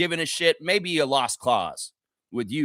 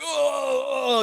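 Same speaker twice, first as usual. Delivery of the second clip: high, drawn-out mock moan; mock pained groan; 6 semitones higher, slower than usual. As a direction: groan as if doubled over in pain. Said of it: "you're doubling over, like".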